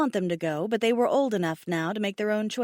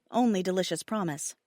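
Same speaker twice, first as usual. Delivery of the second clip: ordinary delivery, nothing marked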